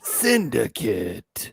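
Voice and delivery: movie guy voice. Pretend. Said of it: "echoing the movie guy".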